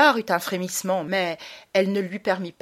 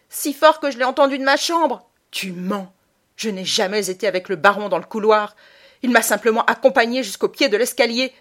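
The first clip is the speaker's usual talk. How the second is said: loud, much higher furious cry; angry outburst; 4 semitones higher, 7 dB louder than usual. Acted. Boy furious at his mother, shouting. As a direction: explode at her.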